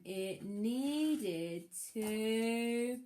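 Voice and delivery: slow, suspenseful drawl; very slow build-up. Acted. Reader slowing right down before the big reveal. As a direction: stretch every word, teasing the punchline.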